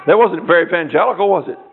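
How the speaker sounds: ordinary talk, nothing marked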